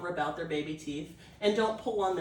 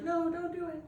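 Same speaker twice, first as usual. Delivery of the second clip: a light, higher mock-puppy protest; voicing the puppy; 9 semitones higher, 3 dB quieter than usual. Trainer voicing the puppy refusing a mouth check, lightly higher mock protest. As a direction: lightly voice the pup saying no. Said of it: "He's like".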